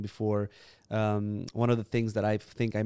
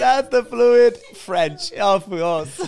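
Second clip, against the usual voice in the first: laughing hard, much higher and louder, slowed right down; 13 semitones higher, 11 dB louder, much slower than usual. Real, not acted. Guest laughs at the hosts' fluid Romanian, high and slow. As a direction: crack up at the joke. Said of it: "we're fluid".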